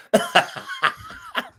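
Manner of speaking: a high burst of real laughter. Real, not acted. Laughs at his own story.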